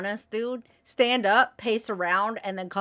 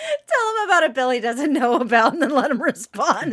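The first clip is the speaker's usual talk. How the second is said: loud, high, laughing interjection; laughing loudly; 4 semitones higher, 7 dB louder than usual. Real, not acted. Co-host cracking up while egging the host on, loud laughing. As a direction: call it out through big laughter.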